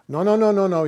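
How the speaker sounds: mock insistent clerk shutting him down; emphatic retold refusal. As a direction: imitate the clerk cutting him off firmly.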